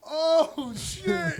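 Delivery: high-pitched, stretched-out amazement; mock amazed. Kid watching mom defy teacher, high mock amazement. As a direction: let it rise into a thrilled kid's squeal.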